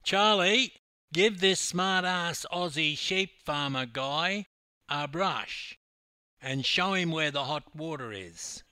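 loud high yell across the room; loud shout over the shoulder. A narrator's reading. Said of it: "yelled over his shoulder".

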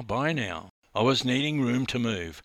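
ordinary delivery, nothing marked

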